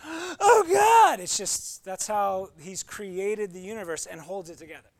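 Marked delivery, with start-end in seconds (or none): 0.0-1.2 much higher and louder hammed-up awe; mock dramatic gasp. Pastor mocking people who expect a mystery, hammed-up loud awe. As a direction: gasp it out like a huge mystery revealed.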